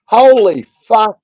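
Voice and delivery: loud, high burst of surprise; shocked exclamation. Real, not acted. Amazed at a stream-mate rolling for hours, loud shocked curse. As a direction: blurt it out, genuinely stunned. Sees seven and a half hours.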